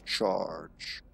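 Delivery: low, drawn-out growl of "charge"; half-hearted monster battle cry, stretched out; mock charging scream. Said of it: "screaming whenever he charges, he goes".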